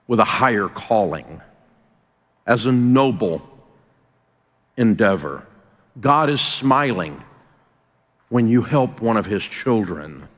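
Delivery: low, measured, with long pauses; solemn speech. Real, not acted. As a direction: let each phrase land with weight. Solemn moral point to audience.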